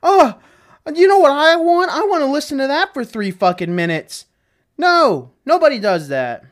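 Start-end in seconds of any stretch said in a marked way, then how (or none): 0.0-4.2 high, loud sarcastic gushing fan; mock fan gushing. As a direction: ham up an over-excited listener. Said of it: "Nobody goes".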